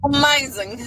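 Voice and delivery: high, loud delighted cry; excited. Real, not acted. Guest echoing the host's praise, loud and high. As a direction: burst out with delight.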